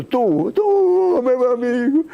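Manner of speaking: effusive, high-pitched greeting to a close friend; como um abraço. Shown as a demonstration. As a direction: greet a dear friend with a rising, drawn-out embrace. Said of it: "é como se dê um abraço". (saudação calorosa e aguda, como um abraço)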